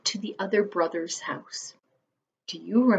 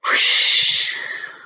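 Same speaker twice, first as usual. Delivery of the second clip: wolf blowing with all his might; big blowing puff. A narrator's reading. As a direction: blow out hard. Wolf blows hard.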